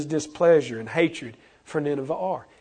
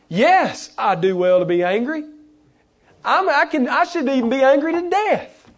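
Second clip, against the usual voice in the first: indignant Jonah; 8 semitones higher than usual. Acted. Jonah's defiant answer acted out.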